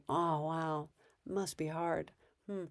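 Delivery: soft, flat, uninvolved sympathy; brain dead reply. Shown as a demonstration. Parent going brain dead with angry kid, soft and flat. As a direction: murmur it without engaging. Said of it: "I'm just gonna say".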